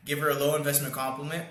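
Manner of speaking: usual speaking voice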